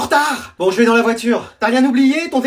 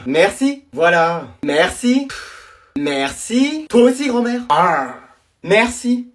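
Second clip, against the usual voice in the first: ordinary delivery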